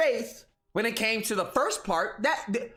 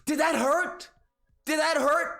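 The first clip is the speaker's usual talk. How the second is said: higher, mild dopey taunt; mild dopey fanboy taunt; 5 semitones higher than usual. Acted. Xbox fanboy taunting in a dopey voice. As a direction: needle him like a dim fanboy. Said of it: "this whole Tard voice thing he's doing".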